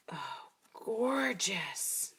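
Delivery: very slow, drawn-out admiring coo; awed admiration. Real, not acted. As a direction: linger on it in slow wonder. Admiring the beautiful card bases.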